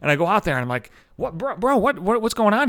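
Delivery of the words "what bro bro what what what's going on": confused. Retold or imitated. Confused about the commotion.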